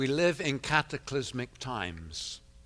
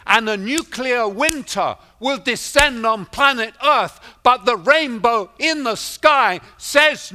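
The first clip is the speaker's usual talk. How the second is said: loud, high-pitched proclamation; preacher declaring hope at full voice; full-force preaching; 9 semitones higher, 9 dB louder than usual. Real, not acted. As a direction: build to a triumphant pulpit cry. Preaches to congregation.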